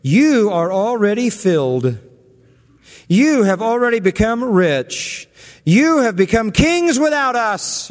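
scornful, over-the-top flattery; sarcastic. Retold or imitated. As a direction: lay on the fake admiration. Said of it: "he takes them on with very sarcastic language".

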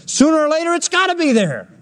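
high, ringing preacher's insistence; preaching fervor. Real, not acted. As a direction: lift it up and drive it home. Preacher rising to emphatic peak.

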